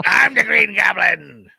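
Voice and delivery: slow, loud villain growl; Green Goblin voice. Pretend. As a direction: announce yourself like a cackling villain. Dafoe impression quote.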